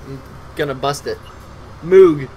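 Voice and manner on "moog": Moog with a long oo; trying out a pronunciation. Shown as a demonstration. Streamer testing how the summon's name is said, trying out a pronunciation. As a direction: say Moog loudly, rhyming with fugue. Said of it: "How do you say it?".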